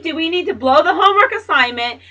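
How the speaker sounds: loud, exasperated yell at daughter; really yelling now; angry shout. Real, not acted. Mother shouting at her daughter about the candle, angry yell.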